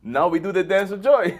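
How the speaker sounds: high, loud mock-foreign glee; sitcom catchphrase impression. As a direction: crow it like a naive sitcom immigrant. Quoting Balky's sitcom catchphrase.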